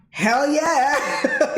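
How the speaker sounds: eager, laughing retold yes; enthusiastic dad. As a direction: imitate a thrilled dad, laughing. Dad retold, keen to see car.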